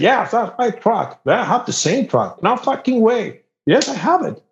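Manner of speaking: high, eager back-and-forth; retold excitement. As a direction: act out amazed truck-owner banter. Retold excited discovery of same truck.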